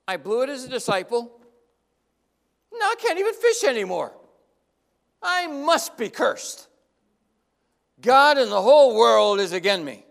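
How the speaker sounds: a defeated fisherman's rising cry; despairing outburst. Acted. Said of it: "He's thinking".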